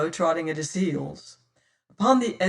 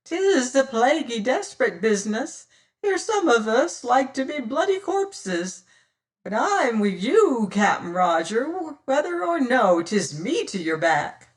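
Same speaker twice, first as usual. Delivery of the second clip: high piping cry; piped; 6 semitones higher, 4 dB louder than usual. Acted. Smiling Sam pleading with the captain, high and piping. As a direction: pipe it up like a fat sailor.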